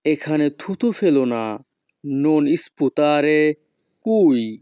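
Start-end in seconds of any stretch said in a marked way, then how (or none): 2.1-4.6 each Italian word spaced out very slowly; slow teaching model. Shown as a demonstration. Teacher models Italian phrase for students, very slow. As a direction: spell it out slowly for learners to copy. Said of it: "Italian ভাষা".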